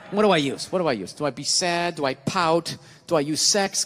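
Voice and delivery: low-pitched scheming inner voice; scheming wife. Acted. Wife plotting how to manipulate her husband, scheming. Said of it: "gotta go into manipulation mode".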